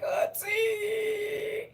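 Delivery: high crying call; crying wail. Retold or imitated. Showing how she cries at gifts, wailing. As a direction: wail Auntie through tears. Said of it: "I just cry here and be like".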